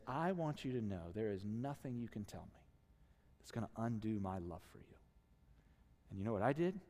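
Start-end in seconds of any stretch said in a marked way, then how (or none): 0.1-5.0 low, soft motherly reassurance; hushed, loving promise; tender reassurance. Retold or imitated. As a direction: speak gently, as if holding your child. Mother reassures child while hugging him.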